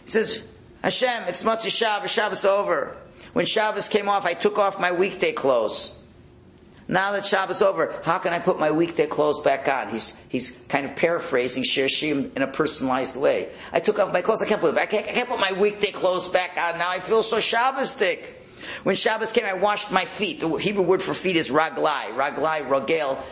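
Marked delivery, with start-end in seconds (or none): 0.8-9.9 higher, heartfelt plea to God; pleading rebbe. Retold or imitated. Rebbe alone pleading to God as Shabbos ends, lightly voiced. 13.7-20.4 higher, heartfelt plea to God; pleading rebbe. Retold or imitated. Rebbe alone pleading to God as Shabbos ends, lightly voiced.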